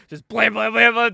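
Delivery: loud mouth gunshots, blam blam; retelling a shootout with loud vocal gunshots; gunshot noises. Retold or imitated. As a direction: bark out the shots loud and high. Imitating gunshots.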